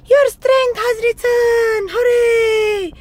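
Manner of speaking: very high, loud jubilant mouse; exclaimed. A narrator's reading. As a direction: cheer joyfully as a little mouse. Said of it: "Mouse exclaimed".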